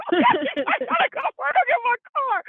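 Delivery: panicked squeal. Retold or imitated. Telling Vanessa about fleeing the lightning, a high laughing squeal. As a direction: squeak it out, half laughing, ready to run.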